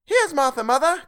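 put-on two excited little kids shouting it out; children crying out. A narrator's reading. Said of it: "cried the two young Cratchits".

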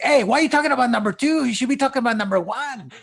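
high, fast heckler voice; rapid-fire playful complaint; mock protest. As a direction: jump in loud and indignant, hammed-up.

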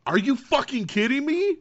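high, loud disbelieving yell; are you kidding me, screamed; outraged yell. Real, not acted. Outraged reaction to cameo.

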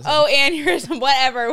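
loud, high, laughing brush-off; dismissive mock. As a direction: wave it away with a laugh. Mocking dismissive reply.

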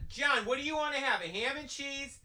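high, soft sing-song call; lightly voiced mom calling out. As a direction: coax him like a mother calling upstairs. Mom calling sweetly to John.

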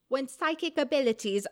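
light Hermione Granger British voice; a hint of a prim British schoolgirl; British accent. Co-host rereading a John Oliver quote as Hermione. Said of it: "oh, I'm doing Hermione".

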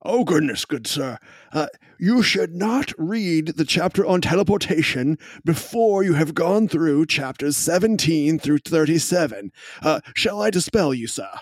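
high, prim scholarly gentleman; a proper old-world gentleman voice; fussy gentleman. Acted. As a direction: scold gently like a pompous librarian. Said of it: "looks at you and goes".

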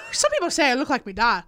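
quick Irish accent, imitating a song; playful mock Irish accent. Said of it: "only thing I know about Irish".

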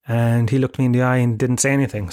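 ordinary talk, nothing marked

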